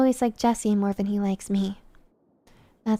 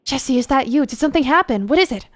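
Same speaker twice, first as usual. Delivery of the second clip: raised, loud, urgent questioning; demanding; 4 semitones higher, 6 dB louder, faster than usual. A narrator's reading. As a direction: demand answers down the phone. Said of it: "his voice demanding".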